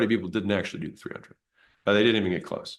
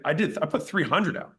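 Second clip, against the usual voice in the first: higher, defensive and snippy; said really mean; 6 semitones higher than usual. Retold or imitated. To his mentor, a mean defensive reply. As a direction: answer as if insulted by the question. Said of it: "I said it, like, really mean".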